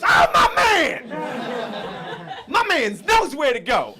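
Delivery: very high, drawn-out shout of delight; hammed-up hype-man clerk; super-pumped clerk. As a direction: holler loud and high, stretching the opening. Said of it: "He was super pumped".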